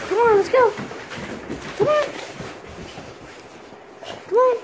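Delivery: high, loud call to a dog; bright-eyed "come along" dog call. Real, not acted. Calling the dogs to come.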